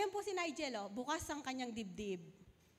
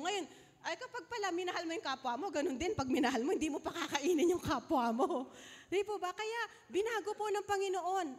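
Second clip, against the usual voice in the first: loud preaching; 4 semitones higher, 6 dB louder than usual. Real, not acted. Preacher drives home the point.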